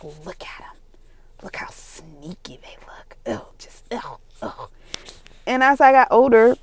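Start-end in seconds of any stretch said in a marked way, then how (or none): 0.0-4.5 soft, low mock revulsion; disgusted. Best friend eyeing sneaky cats, disgusted. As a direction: mutter it with hushed disgust. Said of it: "she's just so disgusted".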